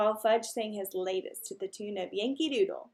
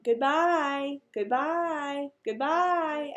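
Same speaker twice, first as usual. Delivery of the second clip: higher squawky goodbyes, louder; bird calling out; 5 semitones higher, 4 dB louder, much slower than usual. Acted. Mynah bird calling goodbye as Peter leaves. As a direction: call it out like a parrot. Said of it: "Uncle Feather called out".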